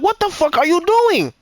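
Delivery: high, incredulous demand; retold indignant disbelief. Man recounting pushing partner away, indignant.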